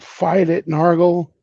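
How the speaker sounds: rallying cry to a possessed friend, shouted; in-character shout. Acted. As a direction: yell it to snap him out of it. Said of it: "And I shout".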